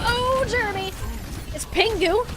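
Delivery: ordinary talk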